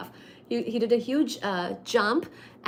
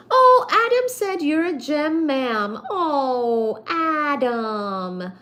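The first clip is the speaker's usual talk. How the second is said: touched; 6 semitones higher, 6 dB louder, much slower than usual. Real, not acted.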